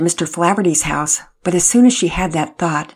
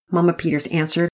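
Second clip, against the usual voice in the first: ordinary delivery, nothing marked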